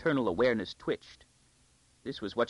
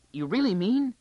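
put-on girl's voice jumping high and louder; amazed girl; 11 semitones higher, 5 dB louder than usual. A narrator's reading. Girl offered pearls by a lady, amazed. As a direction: gasp out the question in delight.

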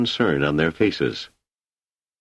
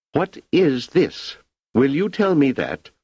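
furious imperial challenge; angry; 9 semitones higher than usual. Acted. Angry Emperor confronts Demerzel.

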